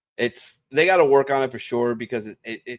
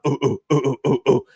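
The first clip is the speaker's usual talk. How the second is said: grunting ape; 2 semitones higher, 3 dB louder, faster than usual. Retold or imitated. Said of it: "he starts grunting".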